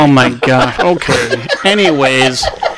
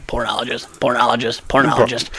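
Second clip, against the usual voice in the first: quiet; 4 semitones lower, 10 dB quieter, faster than usual. Retold or imitated. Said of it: "he keeps on hearing".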